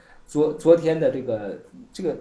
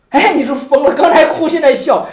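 amused exclamation; 8 semitones higher, 9 dB louder than usual. Acted. Teacher marvels at crying then laughing.

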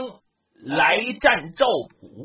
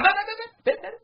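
high rapid protest; urgent stopping; 13 semitones higher, faster than usual. Acted. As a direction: hold him back in a hurry. Allies stop him rushing in.

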